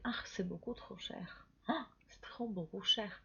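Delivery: hushed aside between shoppers; customers talking among themselves. Acted. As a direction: drop the voice, grumble privately to a companion. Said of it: "Los clientes van a hablar entre ellos".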